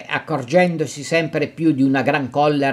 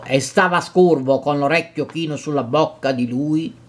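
ordinary delivery

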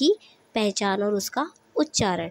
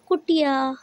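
naming the picture; 5 semitones higher, slower than usual. Shown as a demonstration. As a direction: call out "कुटिया" up high like a reveal to the class. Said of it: "ये क्या है?".

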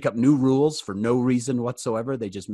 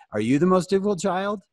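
ordinary delivery

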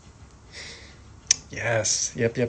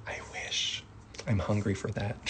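soft breathy whisper of longing; a yearning hush; 8 dB quieter than usual. Shown as a demonstration. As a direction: whisper it like you crave it. Acting out wishing longingly.

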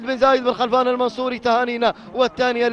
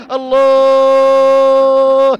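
long stretched cry, much louder; drawn-out shout; 8 dB louder, much slower than usual. Real, not acted. Commentator roars as the camel surges ahead, a long ecstatic shout. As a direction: ride one held note of pure thrill.

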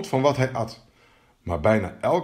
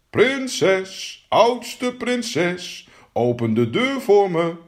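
high, drawn-out chanting call; high-pitched singsong summons; frog calling out; 6 semitones higher, much slower than usual. Acted. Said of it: "en riep".

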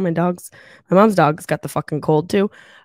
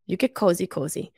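cooing to dog; 4 dB quieter than usual. Real, not acted.

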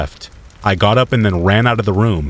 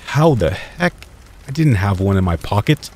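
shocked. A narrator's reading. Boy finds a cigarette he never had, shocked. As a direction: caught off guard, mild disbelief. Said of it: "I said in a shocked tone".

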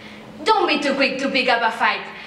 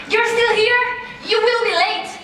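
surprised; 9 semitones higher than usual. Acted. Mother finds son still home.

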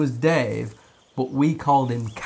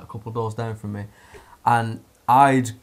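usual speaking voice

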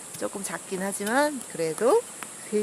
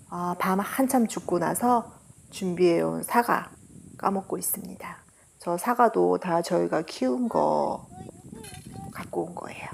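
whispered voiceover; 3 semitones lower, 5 dB quieter than usual. Real, not acted. Voiceover narration while eating.

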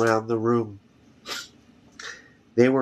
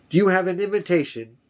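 higher, fast haughty official; a hint of a pompous court official; haughty chamberlain; 7 semitones higher, faster than usual. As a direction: look down your nose at them. Haughty chamberlain addresses intruders.

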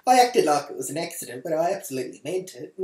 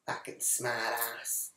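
muttered thought; 6 semitones lower, 11 dB quieter, slower than usual. Retold or imitated. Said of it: "I thought to myself".

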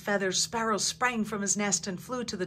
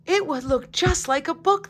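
gleeful, higher and louder; 9 semitones higher, 6 dB louder than usual. A narrator's reading. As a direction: burst out with delight at the idea. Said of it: "the dandelion said with glee".

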